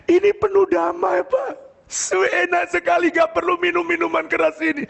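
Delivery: high-pitched acted wonder; amazed neighbour. Drunk neighbour tells the pastor how peaceful it feels, amazed. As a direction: imitate a man marvelling, voice pitched right up.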